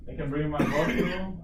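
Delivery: ordinary talk, nothing marked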